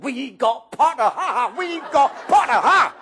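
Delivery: loud, high gloating cheer; Weasley twins celebrating; twins yelling. A narrator's reading. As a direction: crow with glee. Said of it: "twins yelled".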